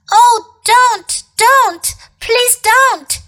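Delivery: high, loud desperate plea; shouting boy. A narrator's reading. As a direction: shout it out, begging. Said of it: "shouted Edmund".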